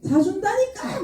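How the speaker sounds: exasperated high-pitched protest; mock-indignant insistence; retold quarrel. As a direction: act out arguing with mum, pitched well up. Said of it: "엄마랑 막 대판 싸우고".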